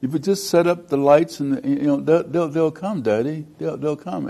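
lightly imitated daughter reassuring her daddy; child pleading with father. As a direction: raise the pitch, a hint of a little girl. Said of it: "she says to her father".

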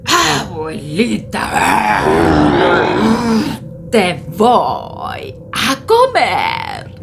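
slow, shouted lion threat; roaring lion. Acted. Lion threatening the grandma, slow and roaring. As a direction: roar it out slowly.

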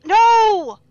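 loud, high despairing cry; anguished yell. Real, not acted. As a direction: cry out in defeat. Lockpick fails.

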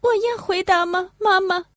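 put-on high, small child asking; child's voice. Little Lucy asking her mother, in a high child voice. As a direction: ask shyly like a little girl.

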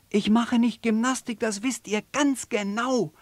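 hoch und laut gereizt. Acted. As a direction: wehr dich gereizt. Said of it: "sagt das kleine Känguru". (gereiztes Känguru)

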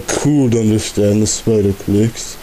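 ordinary delivery

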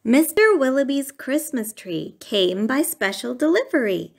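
usual speaking voice

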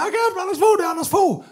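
high, excited call; excited waving shout. Retold or imitated. Waving at the politician's car, high excited call. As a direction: call out like a star-struck fan. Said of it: "vinker og siger".

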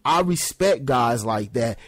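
normal talking voice

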